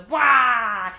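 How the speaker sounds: loud, drawn-out villain roar; Ganondorf's battle cry. Acted. Storyteller voicing Ganondorf facing Link, loud battle roar. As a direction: belt out a big mock bellow that falls away. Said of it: "And he goes".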